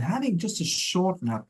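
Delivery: "short" lifted high in pitch; stress on SHORT. Real, not acted. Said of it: "I wanna emphasize the word short".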